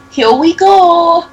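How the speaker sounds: high, loud, slow tiny doll voice; little doll voice. Acted. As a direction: squeal it like a tiny living doll. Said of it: "saying in her little voice".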